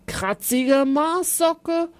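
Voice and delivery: put-on playful kid still cross; silly insults. A narrator's reading. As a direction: keep hurling made-up insults. Zolli not finished insulting.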